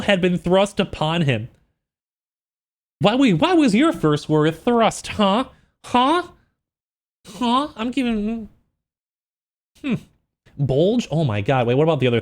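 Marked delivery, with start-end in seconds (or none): none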